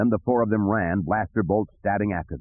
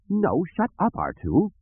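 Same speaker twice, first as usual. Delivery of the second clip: high, fussy droid scolding; flustered droid; 11 semitones higher, slower than usual. A narrator's reading. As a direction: snap at a fellow droid in a high voice. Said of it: "three P O said".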